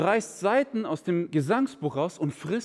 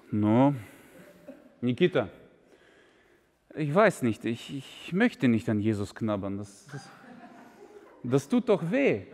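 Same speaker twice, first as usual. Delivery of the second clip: put-on low, worried Russian accent; Russian-accented Viktor; 7 semitones lower than usual. Viktor mit Akzent. (tiefer russischer Akzent, besorgt)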